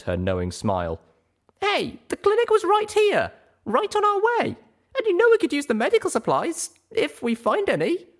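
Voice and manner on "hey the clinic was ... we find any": high, defensive filly voice; high young mare voice. Acted. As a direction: talk yourself out of it, flustered. Pip voiced in story.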